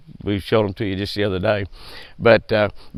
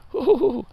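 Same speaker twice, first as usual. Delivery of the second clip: high falsetto woo-hoo-hoo; tickled high hoot over his clean boat; gleeful whoop. Real, not acted. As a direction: whoop with delight at the shiny rig.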